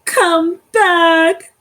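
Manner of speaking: high, loud shout after the cone; high, loud desperate call. Acted. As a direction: shout to the bird flying off. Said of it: "shouted Cyril".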